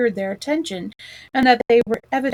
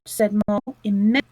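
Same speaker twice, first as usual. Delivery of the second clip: ordinary delivery, nothing marked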